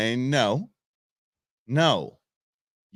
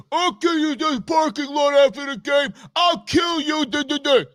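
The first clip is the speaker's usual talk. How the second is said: mock fan threat; 13 semitones higher than usual. Yankees fan threatening the ump, mock furious shout.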